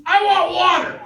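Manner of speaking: high, loud whiny yell; yelling demand. Retold or imitated. Scott yelling at the cop for water, high loud whine. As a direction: shout it up at the cop like a tantrum. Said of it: "instead yells at the cop".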